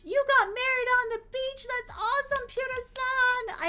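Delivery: high, loud squeal of delight; excited congratulations. Real, not acted. Streamer congratulates viewer on beach wedding, thrilled. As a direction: gush with joy, drawing out the name at the end.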